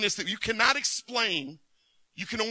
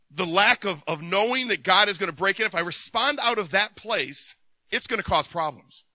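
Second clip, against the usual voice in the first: ordinary talk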